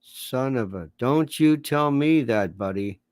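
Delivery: mock yelling contest in a low, dull drone; George Will and Victor Davis Hanson. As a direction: threats delivered with no energy at all. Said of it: "George Will and Victor Davis Hanson in a yelling contest".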